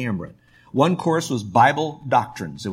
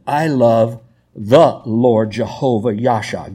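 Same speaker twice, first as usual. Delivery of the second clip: slow line with "the" set apart; stress on "the"; 3 semitones lower, 5 dB louder, slower than usual. Shown as a demonstration. Preacher correcting the article, slow with stressed "the". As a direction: land heavily on "the" before "Lord". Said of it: "Notice the word "the".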